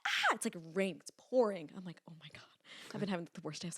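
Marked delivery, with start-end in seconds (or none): 0.1-0.4 very high short mock shriek. Old lady shrieks at her helper, imitated shriek. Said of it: "She's like". 2.1-2.4 muttered. Retold or imitated. Exasperated in the rain.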